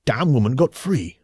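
stretched-out cry of frustration; exasperated outburst. A narrator's reading. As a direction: blurt out the bad news crossly. Freed man cries out his news.

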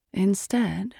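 ordinary delivery, nothing marked

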